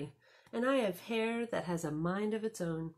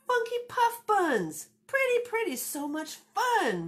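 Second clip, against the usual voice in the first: cat Rocky approving; 5 dB louder than usual. Acted. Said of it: "Rocky approved too".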